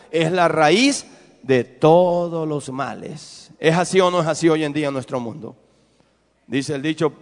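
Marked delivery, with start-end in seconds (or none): 0.1-3.5 verse drawn out slowly for the church to repeat; slow recitation. Shown as a demonstration. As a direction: spell the verse out slowly, word by word, so they can join in. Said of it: "dígalo".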